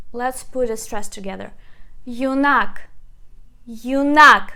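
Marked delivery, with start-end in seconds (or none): 2.1-2.8 "Юнак" with the final а louder and held; stress on the second syllable. Shown as a demonstration. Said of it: "We put stress on a". 3.7-4.5 "Юнак" with a loud, drawn-out stressed а; stress on the second syllable. Shown as a demonstration. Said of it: "We put stress on a".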